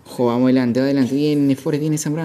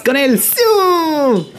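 very slow, much higher, stretched Siu cry; drawn-out Siu; 10 semitones higher, 5 dB louder, much slower than usual. Acted. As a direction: hold the Siu like a stadium chant.

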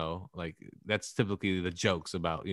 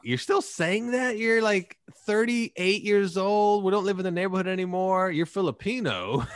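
incredulous scolding; 11 semitones higher, 4 dB louder than usual. Retold or imitated. Reacting to his friend Ryan, high-pitched disbelief. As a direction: needle an old friend who should know better. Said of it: "And I'm like".

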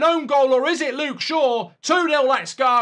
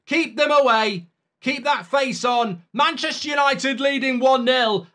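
normal talking voice

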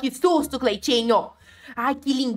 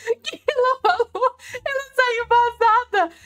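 high-pitched giggly squeal, laughing through it; high giggling voice; 10 semitones higher than usual. Real, not acted. Player laughing at the fleeing ghost, high and giggly.

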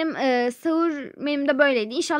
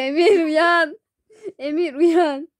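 whiny waking call; 4 semitones higher, 7 dB louder, much slower than usual. Acted. Waking the boy.

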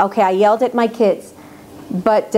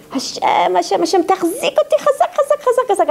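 high, insistent cry to Hashem; urgent plea to Hashem; 7 semitones higher than usual. Retold or imitated. Woman calling on Hashem to hold her, urgent pleading. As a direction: repeat it over and over, pitched up and pleading. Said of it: "my words are always".

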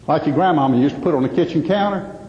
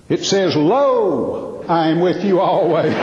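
"Lo" drawn out slow and stressed; slower than usual. Retold or imitated. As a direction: lean hard on "Lo", then the quote. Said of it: "He said".

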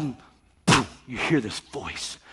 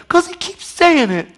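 much higher, slow mocking whine; mock whiny excuse; 7 dB louder than usual. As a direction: parody a lame excuse.

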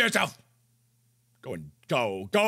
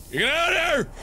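shooing yell; 8 semitones higher than usual. Real, not acted. Yelling at a game monster.

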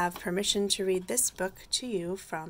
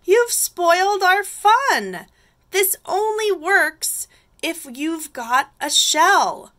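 high, loud storybook complaint; indignant snails telling someone off; scolding cry; 10 semitones higher, 15 dB louder than usual. A narrator's reading. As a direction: whine crossly at the culprit. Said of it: "they cried".

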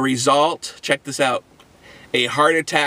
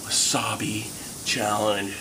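breathless whisper of wasabi; 9 semitones lower, 9 dB quieter than usual. Real, not acted. Whispers scary ingredient name.

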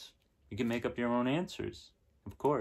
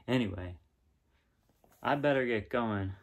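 plain reading voice

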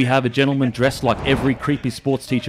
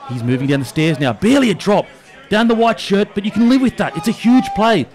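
hammed-up commentator, rising higher as tension builds; mock play-by-play; 4 semitones higher than usual. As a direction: call the pub carry like a footy final. Mock commentary on carrying beers.

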